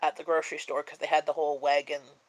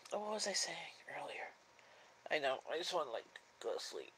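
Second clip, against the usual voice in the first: whispering; 16 dB quieter, faster than usual. Real, not acted. Sleepy streamer trails into whisper.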